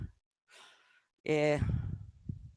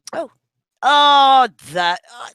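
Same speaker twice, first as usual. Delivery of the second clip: surprised; 6 semitones higher, 10 dB louder than usual. Real, not acted. Notices the friend is listening.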